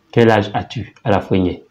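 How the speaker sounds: ordinary talk, nothing marked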